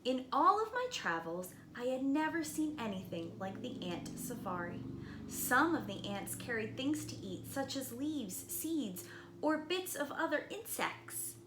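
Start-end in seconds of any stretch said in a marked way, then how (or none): none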